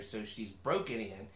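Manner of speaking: ordinary delivery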